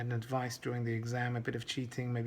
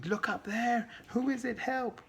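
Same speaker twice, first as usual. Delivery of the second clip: a much higher, hammed-up fake alarm cry; mock scream; 10 semitones higher than usual. Kid fakes a scream for help to distract the teacher, much higher. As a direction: pretend panic to distract the teacher. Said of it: "The kid suddenly yells out, screaming".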